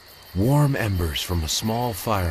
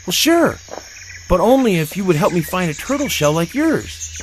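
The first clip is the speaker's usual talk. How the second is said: much higher and louder, eager; excitedly; 11 semitones higher, 6 dB louder than usual. Acted. Tigus eagerly agreeing to Zeeby's deal, excited. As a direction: squeal it with delight. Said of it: "Tigus said, excitedly".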